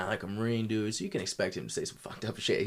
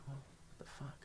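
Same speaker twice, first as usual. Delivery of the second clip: breathy unvoiced whisper, much softer; whispered aside; 5 semitones higher, 18 dB quieter, faster than usual. Muttering disbelief at the boss, whispered. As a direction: hush it out in disbelief. Stunned reaction to boss's remark.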